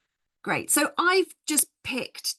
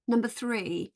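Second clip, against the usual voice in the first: ordinary delivery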